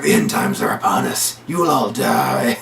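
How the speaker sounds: low, slowing demon voice; hammed-up possessed growl; demonic possession. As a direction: intone a prophecy of doom, drawing out the end. Possessed [name], glowing green eyes.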